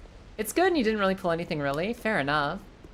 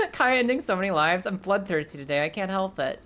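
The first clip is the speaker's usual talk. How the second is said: ordinary delivery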